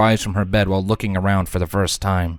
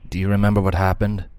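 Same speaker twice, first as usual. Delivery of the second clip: ordinary delivery, nothing marked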